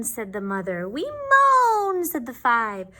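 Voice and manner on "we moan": high, louder moaning; little mummies moaning. Acted. Little mummies answering mother, moaning. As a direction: moan it high like tiny mummies.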